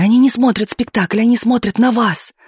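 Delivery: high, urgent indignation; choking with anger. A narrator's reading. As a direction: pour it out in a fury. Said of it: "захлёбывалась шёпотом и гневом Галина".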